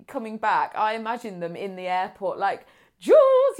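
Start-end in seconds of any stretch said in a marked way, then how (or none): 3.0-3.6 high, loud squeal of a name; excited greeting. Acted. Friends greeting a returning traveller at the airport, excited shout. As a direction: burst out calling to a long-awaited friend.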